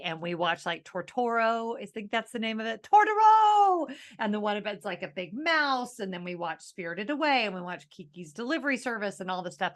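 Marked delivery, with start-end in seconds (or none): 2.8-3.9 shouted exclamation. Pretend. Calling out the movie's name.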